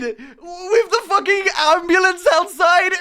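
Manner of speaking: high, loud words broken by laughter; laughing disbelief. Real, not acted. Retelling the Christmas bar chaos, laughing loudly.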